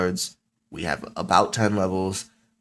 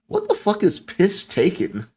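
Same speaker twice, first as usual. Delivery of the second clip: high, slow disbelief; baffled outburst; 9 semitones higher than usual. Real, not acted. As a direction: draw it out in high-pitched bafflement. Baffled by an unexpected file name.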